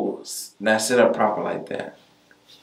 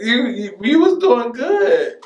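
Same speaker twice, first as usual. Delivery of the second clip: laughing; 4 dB louder than usual. Real, not acted. Laughing reassurance.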